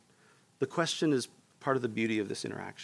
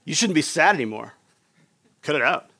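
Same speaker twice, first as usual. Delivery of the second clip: louder, impatient mock rebuke; mock scolding; 3 semitones higher, 10 dB louder than usual. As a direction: scoff and tell her to cut it out. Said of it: "we shouldn't read that as a scolding".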